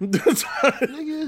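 loud high laughing call-out. Real, not acted. Laughing reply to friend's excuse.

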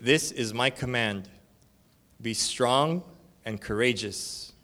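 slow, weighty scripture read. Acted. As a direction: proclaim each phrase slowly. Reading scripture aloud.

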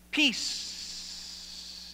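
Peace breathed out long and soft, almost whispered; drawn-out peace. Shown as a demonstration. Congregation repeating the word with the pastor, long and breathy. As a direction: let Peace linger on the breath. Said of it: "Say that with me".

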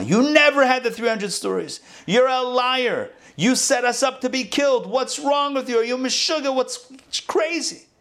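high-pitched accusing outburst; shrill-free but high, hurl the accusations; angry accusations. Retold or imitated. Animals accusing the fox of leading them to death, high and angry. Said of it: "accusations fly".

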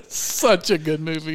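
slow, laughing delight at a favorite gag. Real, not acted. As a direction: crack up while praising it. Laughing at a quoted movie gag.

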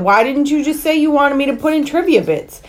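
flustered, defensive outburst; real exasperated protest. Real, not acted. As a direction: protest at being put on the spot. Protests being put on the spot.

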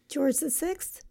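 ordinary delivery

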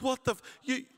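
high startled outburst; shocked. Retold or imitated. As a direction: blurt it out, caught off guard. Shocked reaction to invitation.

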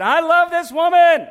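loud, high, slow proclamation of love; husband shouting his love across the restaurant; public declaration. Acted. In a restaurant, husband tells everyone he loves her: loud, high, drawn out.

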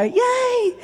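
high squeal of yay; joyful cheer. Real, not acted. Woman cheering her healing to the congregation, squealing high. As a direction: cheer with delight.

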